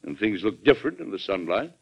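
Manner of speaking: ordinary delivery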